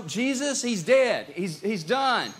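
high, loud, exasperated outburst; fed-up doubter. Acted. Thomas telling disciples that Jesus is dead, acted exasperation. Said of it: "he was over it".

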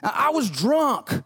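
high, whiny mock excuse-maker. Pastor mocking how people excuse themselves, high whiny pleading. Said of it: "we go back to that sorry thing".

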